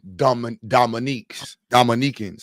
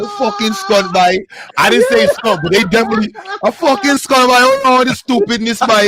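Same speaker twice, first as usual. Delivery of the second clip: loud, high mock Guyanese; hammed-up Guyanese cussing, pitched way up; Guyanese accent; 7 semitones higher, 7 dB louder than usual. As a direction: exclaim like a worked-up Guyanese. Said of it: "grow up with mad Guyanese".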